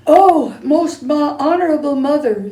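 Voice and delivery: put-on boy out of breath after running; panting. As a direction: gasp the words out of breath. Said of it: "he panted".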